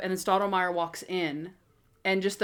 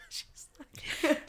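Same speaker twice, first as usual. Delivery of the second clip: ordinary talk, nothing marked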